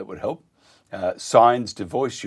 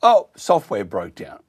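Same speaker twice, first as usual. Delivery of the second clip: normal talking voice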